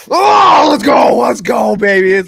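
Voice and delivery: screaming high and loud; hype shout. Real, not acted. Host fires up viewers for Lions game day, screaming hype. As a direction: yell it like the team just scored.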